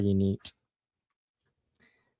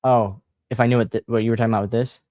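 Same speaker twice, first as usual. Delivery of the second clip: ordinary delivery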